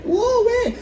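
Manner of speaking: very high, thin swooning squeal; screaming female fans. Retold or imitated. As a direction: squeal like fans losing their minds. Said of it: "Women lose their minds".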